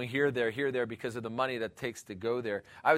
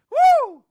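loud high celebratory whoop; joyful whoop; 13 dB louder than usual. Real, not acted. Preacher celebrating freedom with a loud high whoop. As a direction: whoop like at a party.

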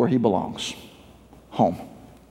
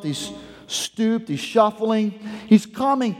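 usual speaking voice

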